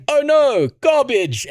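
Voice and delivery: high, loud jokey "oh no, garbage"; mock dismay. Dad joking to his daughter when an ad pops up, mock dismay. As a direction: act playfully disgusted at the ad. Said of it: "I went".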